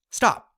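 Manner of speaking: "Stop" high, loud and falling sharply; "Stop" dropped hard from a high start; sharp falling command. Shown as a demonstration. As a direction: command "Stop" like a karate chop. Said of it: "similar to giving a command".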